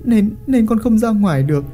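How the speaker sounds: put-on young wife begging the chief to spare her; sobbing plea. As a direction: beg for mercy, voice catching. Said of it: "nức nở van xin".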